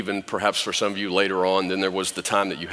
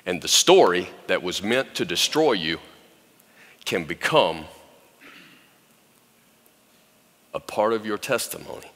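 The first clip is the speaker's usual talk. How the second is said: soft, hushed, tender preaching, much softer; quiet tender sermon; 5 semitones lower, 3 dB quieter than usual. Acted. Preacher softly reassuring hurting women, hushed and tender. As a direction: speak gently to the women who stood up.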